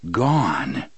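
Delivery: usual speaking voice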